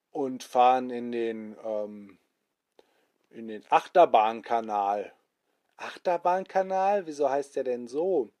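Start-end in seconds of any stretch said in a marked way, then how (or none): none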